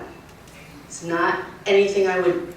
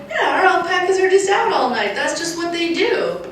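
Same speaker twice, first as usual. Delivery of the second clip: higher, laughing, breezy mock owners; casual owners; 10 semitones higher than usual. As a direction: shrug it off like careless alpaca owners. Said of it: "They're like".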